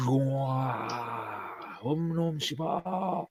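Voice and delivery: very slow, drawn-out spell chant; mock incantation. Cloaked dwarf appears beside a player, slow mock incantation. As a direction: stretch each syllable out like a curse.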